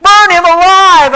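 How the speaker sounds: loud, high crowd shout. A narrator's reading. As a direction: yell it for his death. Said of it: "other voices shouted".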